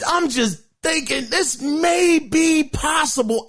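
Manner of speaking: usual speaking voice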